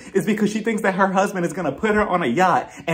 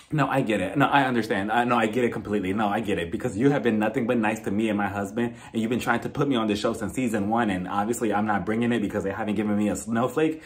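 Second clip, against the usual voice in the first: low, softer imitated castmate; mock understanding in a lowered voice; Angie; 6 semitones lower, 3 dB quieter than usual. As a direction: drop the voice to placate, lightly acted. Voicing Angie placating Jen.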